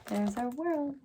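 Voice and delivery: usual speaking voice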